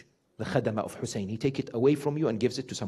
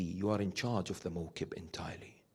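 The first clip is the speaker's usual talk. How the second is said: whisper; 6 semitones lower, 15 dB quieter than usual. Retold or imitated. Father's quoted words in retold story.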